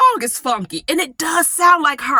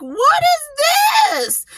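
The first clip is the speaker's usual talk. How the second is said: shocked disbelief. Retold or imitated. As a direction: recoil in shock at what you hear. Shocked reaction to song.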